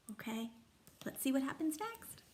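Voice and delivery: ordinary delivery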